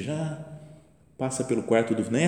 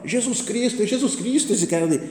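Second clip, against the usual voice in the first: much higher, excited mock-kid cry; excited kid imitation; 10 semitones higher than usual. Grandson spots Christ in the painting, high and excited. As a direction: imitate a boy thrilled by his discovery.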